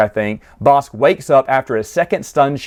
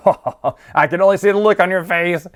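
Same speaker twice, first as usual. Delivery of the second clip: mock guffaw running into higher, laughing words; acted laughter at his son's expense; imitated laughing; 5 semitones higher, faster than usual. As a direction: laugh through it like a gloating father. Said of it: "his father, laughing, going".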